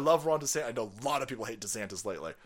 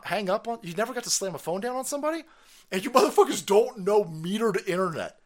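ordinary talk

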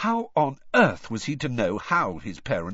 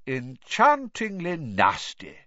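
put-on slow, amused old wizard; twinkling dry wit; Dumbledore; 6 semitones higher, 4 dB louder, slower than usual. A narrator's reading. Headmaster teasing the reporter, slow and amused. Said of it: "his eyes twinkling".

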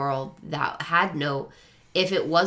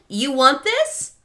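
casual Aslan voice; 14 semitones higher, 7 dB louder than usual. Retold or imitated. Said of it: "Aslan came to and was like".

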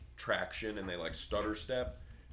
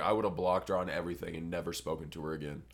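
rushed vow; 2 semitones lower, much faster than usual. Retold or imitated. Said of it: "I was like".

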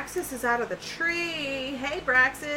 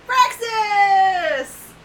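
high loud cry of his name; calling out; 10 semitones higher, 11 dB louder than usual. Acted. Sister answering her brother across the swamp, joyful shout. Evie answers the reunion call.

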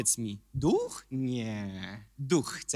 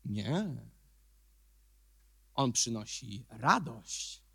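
slow, soft; 8 semitones lower, 6 dB quieter, slower than usual. Acted. Preacher contrasts sadness with joy.

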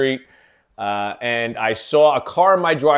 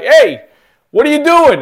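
loud, high-pitched re-enacted shout; retold yell; 11 dB louder than usual. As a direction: yell it out as if challenging a stranger. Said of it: "I yelled, like".

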